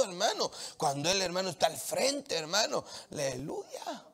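usual speaking voice

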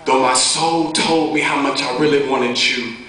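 measured, rhythmic testimony poem; earnest spoken-word delivery with deliberate pauses. Acted. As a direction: recite it like poetry, line by line.

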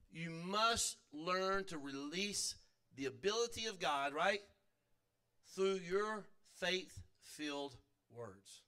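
slow and deliberate, each word weighed; slow emphatic teaching. Shown as a demonstration. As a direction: lay it down slowly so it sinks in. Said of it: "Let me say it again".

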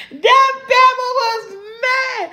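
high, loud, slow laugh-talk; words half sung through laughter. Real, not acted. Telling viewers her son was mad, laughing.

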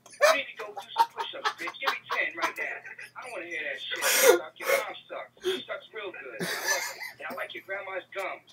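loud, high trash-talk rant; hammed-up trash talk; mock tirade. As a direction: tear into them. Comic tirade at online kids.

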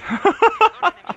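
risa aguda y explosiva; carcajada aguda. Real, not acted. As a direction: suelta una carcajada alta. Se ríe de sí mismo. (carcajada aguda)

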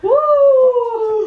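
loud high whoop; excited whoop. Real, not acted. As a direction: whoop it out with joy. Daughter cheering about the trip.

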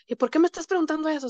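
a rude husband's defensive, fast retort. Retold or imitated. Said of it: "muy altivo, muy grosero".

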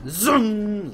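high, loud car noise; playful zoom. Pretend. Mock driving away.

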